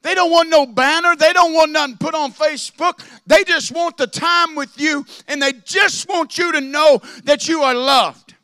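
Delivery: loud, high, ringing preacher's cry; preaching at full force. Acted. Preacher to congregation, rising into a full-force cry. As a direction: lift the room with a shouted sermon line.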